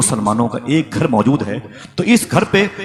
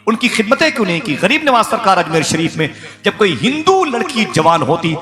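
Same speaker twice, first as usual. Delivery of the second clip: higher, louder fervent reproach; impassioned preaching; 6 semitones higher, 4 dB louder than usual. Acted. Preacher scolding the congregation over neglected neighbours, fervent and raised. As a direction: build the rebuke to a pulpit climax.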